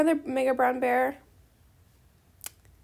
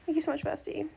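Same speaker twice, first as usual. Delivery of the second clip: soft thanks; 8 dB quieter than usual. Acted. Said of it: "I'm gonna say".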